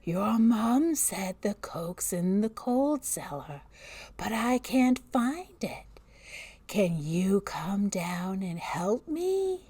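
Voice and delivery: put-on slow, breathy babysitter whisper; eerie muffled whisper. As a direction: lure the child down, slowly. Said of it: "Emily's muffled voice drifted up".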